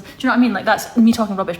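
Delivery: ordinary delivery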